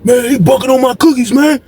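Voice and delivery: high-pitched shouted complaint; mock angry cellmate. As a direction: yell it like the upset cellmate. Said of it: "He said".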